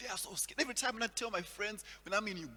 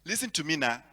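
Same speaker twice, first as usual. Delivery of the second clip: put-on Nigerian accent; 7 dB louder than usual. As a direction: give directions like a Nigerian GPS. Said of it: "there's a Nigerian accent there".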